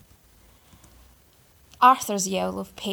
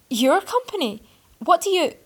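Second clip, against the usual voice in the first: much higher, louder, incredulous; shocked disbelief; 9 semitones higher, 10 dB louder than usual. A narrator's reading. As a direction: splutter in dawning shock. Realizing who Arthur is.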